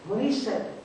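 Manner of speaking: usual speaking voice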